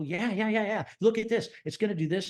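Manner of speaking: high, eager retelling; eager showing-off. Retold or imitated. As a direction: play an enthusiast showing off his model. Eagerly showing model to wife.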